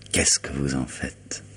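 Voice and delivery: slow, whispered jab; a slow, breathy whispered tease; teasing whisper. Acted. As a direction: whisper it slowly, half mocking. Lawyer teasing about professional secrecy.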